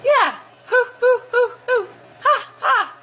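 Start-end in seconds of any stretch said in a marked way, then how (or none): 0.7-1.9 monkey hoots imitation. Shown as a demonstration. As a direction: hoot like a silly monkey for the toddler. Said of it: "What does a monkey say?".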